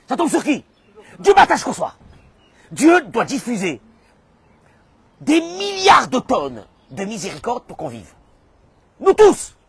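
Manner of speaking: high, loud outraged complaint; exasperated shouting. Acted. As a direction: shout it out, fed up, hammering each phrase. Acts out God's exasperated complaint. (cri d'exaspération, fort et aigu)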